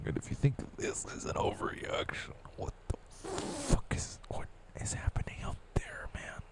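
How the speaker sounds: urgent whisper rising to a squeak. Real, not acted. Ranting at the world in a high whisper.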